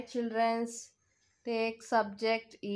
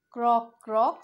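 loud croak; 7 dB louder than usual. Acted. Animal sounds in song.

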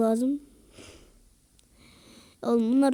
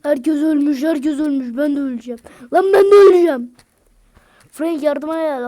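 building to a panicked fast yell; 2 semitones higher, 11 dB louder than usual. Real, not acted. Gamer panicking as his whole team dies.

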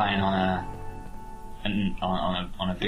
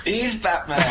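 excited laughing exclaim; 11 semitones higher than usual. Real, not acted. Laughing at the game's Batman moment.

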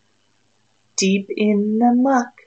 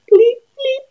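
high, loud bleeping chirps; bright high cricket noise; 7 dB louder than usual. Acted. Cricket sound effect in story.

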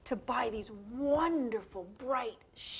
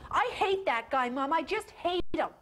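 higher, louder sulky protest; upset kid; 6 semitones higher, 5 dB louder than usual. Acted. Gordy answers Chucky, kid anger. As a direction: snap back like a hurt boy. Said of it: "Gordy was really angry".

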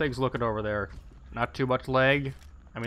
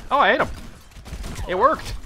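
high, loud burst of surprise; thrilled disbelief, pitched up; surprised delight; 11 semitones higher, 3 dB louder than usual. Real, not acted. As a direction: exclaim it, amazed the shot landed. Surprised own hit in game.

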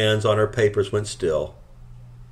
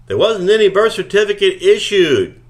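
high, loud indignant shout; 12 semitones higher, 8 dB louder than usual. A narrator's reading. Miss Cleary shouting at the couple. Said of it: "Miss Cleary shouted".

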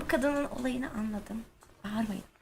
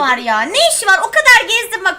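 loud indignant rant; 8 semitones higher, 17 dB louder than usual. Real, not acted. Ranting after jumpscare.

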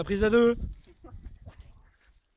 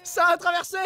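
excited shout; 8 semitones higher, faster than usual. Real, not acted. Shocked commentary.

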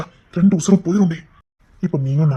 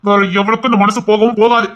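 loud declaration; 5 semitones higher, 7 dB louder than usual. Acted.

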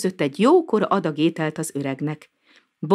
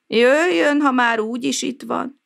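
lamenting; 8 semitones higher than usual. A narrator's reading. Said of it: "kesergett a szomszéd".

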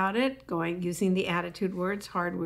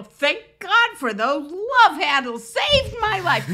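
mock grateful exclaim; 8 semitones higher, 8 dB louder, slower than usual. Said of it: "and they go".

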